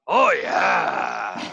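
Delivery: loud, growled mock Pacino hoo-ah; Pacino impression. Friends trading Pacino impressions, a loud growled Oh yeah. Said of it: "Pacino that everyone does".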